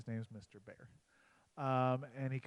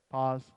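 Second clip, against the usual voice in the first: "Paws" punched loud; "Paws" said loud to land the pun; punchline word; 12 dB louder than usual. Shown as a demonstration. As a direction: hit "Paws" hard and short. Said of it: "P A U S E slash P A W S".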